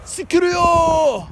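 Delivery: shouted high fake Japanese, slowed on the big words; mock battle cry. Goofing alone in a forest, shouting a mock anime battle cry. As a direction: roar it like an anime hero charging.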